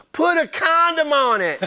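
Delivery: high, drawn-out mock heckle; imitated heckler lady. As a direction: call it out slowly like a lady at the bar. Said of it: "this lady at the bar goes".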